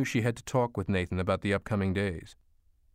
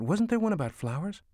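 high, lilting question; gently jog a little boy's memory; mother coaxing a child; 10 semitones higher than usual. A narrator's reading. Mother prompts her young son.

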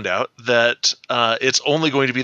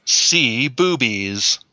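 slow mock demo; much slower than usual. Repeating the slow demo phrase.

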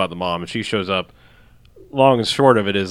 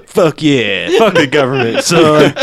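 gun store owner; 3 semitones higher, 4 dB louder than usual. Acted. Gun store owner voiced in retelling.

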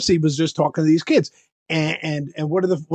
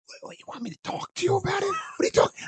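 imitating a strained, nearly voiceless reply; blown voice; 7 dB quieter, faster than usual. Hoarse performer protesting to chatty fairgoer, strained weak voice. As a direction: squeeze out quick, high, failing words. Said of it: "I blew my voice out".

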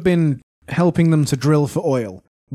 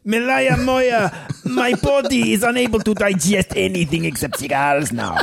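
hammed-up Cuban comrade; Cuban accent; 2 semitones higher than usual. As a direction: plead your case in a mock Cuban accent. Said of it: "we Cubans".

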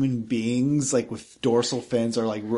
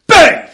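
shouted bang, much higher and louder; loud gunshot bang; 21 dB louder than usual. Acted. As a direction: blurt it out like a gunshot. Gunshot in the story.

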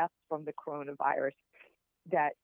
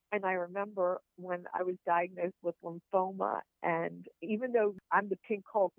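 usual speaking voice

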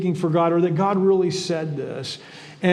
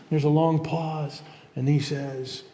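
normal talking voice

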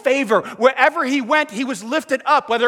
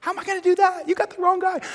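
much higher, incredulous; doubting Moses; 9 semitones higher, faster than usual. Acted. Moses objecting to God, high and incredulous. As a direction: protest like a man who can't believe he's chosen.

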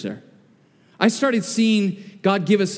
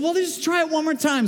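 put-on kids urging; 9 semitones higher than usual. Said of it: "they said".

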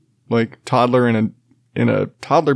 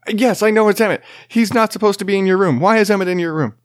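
higher, fed-up parental reply; exasperated dad; 8 semitones higher, faster than usual. Retold or imitated. Dad answering his toddler at naptime, exasperated and higher. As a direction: sound done with this nonsense.

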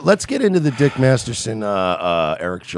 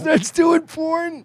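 high and slow; slow deadpan; 13 semitones higher, much slower than usual. Real, not acted. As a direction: drag it out for the joke. Comic slow high line.

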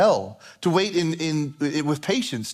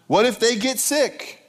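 higher, drawn-out worry up to "sick"; mock freaking out; 5 semitones higher, slower than usual. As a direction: fret theatrically, stretching "what if they get sick". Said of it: "So we start freaking out".

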